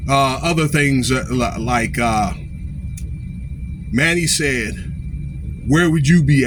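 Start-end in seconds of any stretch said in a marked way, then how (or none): none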